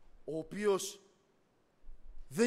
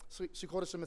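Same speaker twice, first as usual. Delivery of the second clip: normal talking voice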